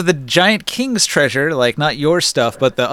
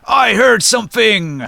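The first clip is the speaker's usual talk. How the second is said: high, slow giant exclamation; 8 semitones higher, 4 dB louder than usual. Acted. Giant running out of a side chamber, high slow voice. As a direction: announce it as a lumbering giant, words stretched. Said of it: "this giant's going to come running out".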